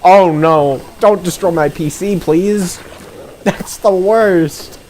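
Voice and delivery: pleading with the beetle; panicked. Real, not acted. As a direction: beg the game in mock panic.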